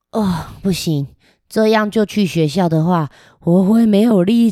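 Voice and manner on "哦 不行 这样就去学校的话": plain reading voice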